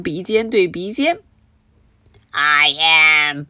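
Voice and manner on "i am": lightly voiced low thief; confessing thief. A narrator's reading. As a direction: admit it in a lower voice. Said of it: "said Leroy Lincoln".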